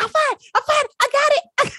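reassuring husband while baking goes wrong, frantic and high; mock panicked reassurance. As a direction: insist you're fine while panicking. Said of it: "I'm like".

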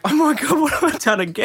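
high, shocked retelling of "what have I done"; a hammed-up gasp of dismay; mock alarm. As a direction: throw your voice up in mock panic. Retells her shock at mentor crying.

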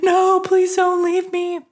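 put-on high, slow pleading little girl voice; inner little girl. Inner child begging boyfriend not to leave, high pleading voice. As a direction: beg like a frightened small child. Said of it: "my inner child, my little girl".

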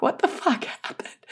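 a high, breathy gasp of disbelief; shocked husband. Retold or imitated. Wife imitating her stunned husband after the birth, shocked, high and breathy. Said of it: "My husband was shocked".

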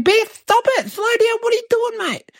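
hanging on for dear life, voice shooting up; mock panic, pleading with the runaway horse; panicked pleading. Clinging to a bolting horse.

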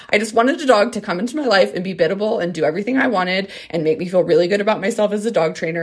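ordinary talk

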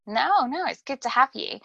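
ordinary delivery

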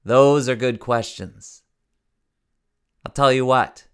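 yelling up. A narrator's reading. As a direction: shout it up to the guard. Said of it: "the man yells up and says".

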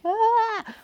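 high yelp of fright; a short panicked squeal, half laughing. Retold or imitated. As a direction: let out a sudden scared yelp. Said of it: "so I'm like".

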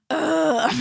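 high exasperated groan; annoyed ugh. Retold or imitated. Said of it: "to get the".

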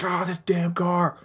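high, slow, hammed-up exasperation at the car; mock frustrated yell. Driver fed up with a junk car that won't start, mock exasperated yell. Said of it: "they yell, they're like".

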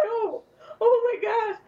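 high-pitched emotional gasp; overwhelmed squeal. Retold or imitated. Overwhelmed by the name's meaning.

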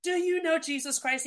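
high, insistent challenge. Retold or imitated. Victim challenging her kidnapper about Jesus, with authority. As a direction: demand an answer with authority. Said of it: "with more authority this time".

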